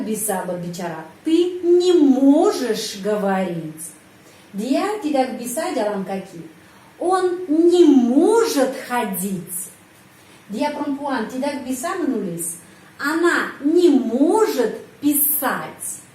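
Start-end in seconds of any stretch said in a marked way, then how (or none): 1.2-3.9 slow, louder, stress on не; slow model sentence. Shown as a demonstration. 7.0-9.7 slow, louder, stress on не; slow model sentence. Shown as a demonstration. 13.0-16.0 slow, louder, stress on не; slow model sentence. Shown as a demonstration.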